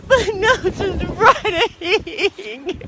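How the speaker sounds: high, loud wailing whine; snotty crying. Acted. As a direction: wail like freezing and miserable. My nose is running.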